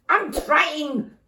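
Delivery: high, loud exasperated shout. Acted. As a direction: snap back under pressure. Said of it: "shouted Mum".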